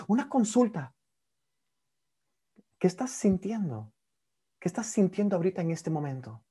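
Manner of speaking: gentle therapist curiosity. Shown as a demonstration. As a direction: ask as if something is happening now. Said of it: "Mi voz cambia".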